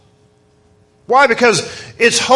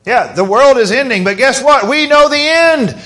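excited preaching; 6 semitones higher than usual. Real, not acted. Preacher rallying the congregation toward hope, excitedly. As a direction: build it up with real fire. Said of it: "I'm getting a little excited".

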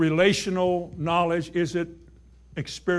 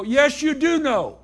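higher, louder and slow insistence; emphatic rebuke; 8 semitones higher, 5 dB louder than usual. Acted. Preacher rebutting a doubting listener loudly. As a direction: insist firmly on each word.

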